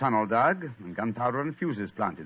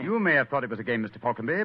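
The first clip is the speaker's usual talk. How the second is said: ordinary talk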